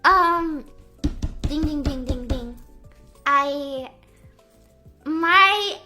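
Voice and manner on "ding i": usual speaking voice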